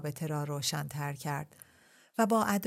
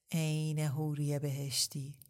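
slow languid repeat; slower than usual. Acted. Ebrahim repeats his compliment, slow and languid. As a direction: drag it out with heavy-lidded eyes. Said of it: "فقط تکرار کرد".

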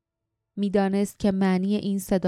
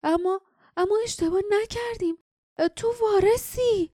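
high, halting stammer, much higher voice; 13 semitones higher than usual. A narrator's reading. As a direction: stumble over the words in disbelief. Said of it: "لیف منمن کنان گفت".

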